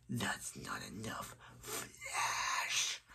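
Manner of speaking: very low, hushed villain voice; low menacing whisper; Zoom voice. Acted. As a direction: sink deep and whisper the threat. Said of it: "I do a very good Zoom voice".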